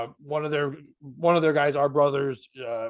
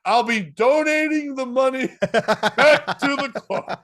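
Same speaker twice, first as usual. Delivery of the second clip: higher, louder, slow, laughing through the words; laughing quote; 8 semitones higher, 7 dB louder, slower than usual. Retold or imitated. Beans heading to the bar, quoted laughing. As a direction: quote him while cracking up. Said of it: "literally goes".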